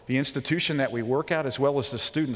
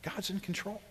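soft, steady, softer words; quiet reassurance; 7 semitones higher, 11 dB quieter than usual. Retold or imitated. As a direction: say it gently, settling him. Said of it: "He said".

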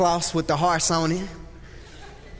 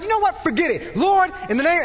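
fed-up outburst; 11 semitones higher than usual. Retold or imitated. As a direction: snap and throw up your hands. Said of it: "it just triggered my flesh".

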